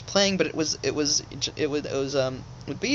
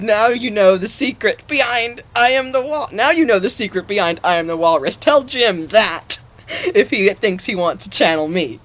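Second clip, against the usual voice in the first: [name] voice; 6 semitones higher, 8 dB louder than usual. Retold or imitated. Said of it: "he said".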